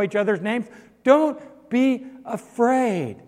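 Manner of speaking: loud, high, building preacher's call; rally the congregation not to fear; impassioned preaching. Acted. Preacher urging the church not to fear, in full voice.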